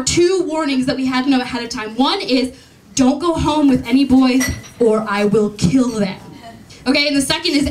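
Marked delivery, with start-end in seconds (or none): 3.0-6.2 slow, low mock-stern warning. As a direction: threaten lightly, like a strict guardian. Retold warning before going out.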